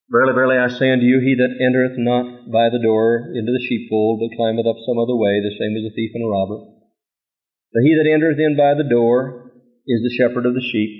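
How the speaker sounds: low, measured Bible reading; scripture reading. Acted. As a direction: read it like scripture, solemn. Reads scripture aloud.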